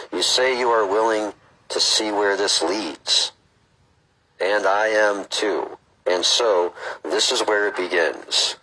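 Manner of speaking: slow, measured read-aloud; book reading. Acted. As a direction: read it out deliberately, phrase by phrase.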